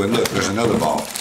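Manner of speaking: ordinary delivery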